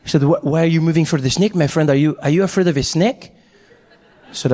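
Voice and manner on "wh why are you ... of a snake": put-on incredulous French-accented questioning. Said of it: "the French guy".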